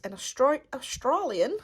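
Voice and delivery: slipping briefly into an Australian accent; accidental Australian accent. Real, not acted. Unboxer reading the artist's bio, Australian accent by accident. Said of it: "went into an Australian accent there".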